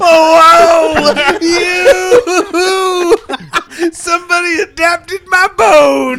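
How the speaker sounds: loud and high with delight; excited. Real, not acted. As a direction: shout it out, thrilled and surprised. Surprise at Bone adaptation news.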